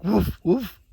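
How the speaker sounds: high, playful mock woof; imitating a dog bark. Owner imitates her dog's barking, high and playful. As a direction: bark back at the dog for fun. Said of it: "You were like".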